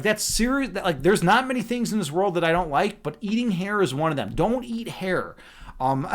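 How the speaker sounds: normal talking voice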